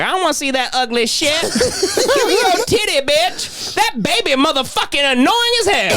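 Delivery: high-pitched imitation of an irate man; mock angry rant. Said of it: "'cause he's like".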